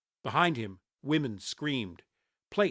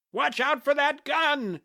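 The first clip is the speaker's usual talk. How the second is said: high, loud alarmed shout; shouted warning; 14 semitones higher, 5 dB louder than usual. A narrator's reading. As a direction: yell a warning to everyone near. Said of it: "another man shouted".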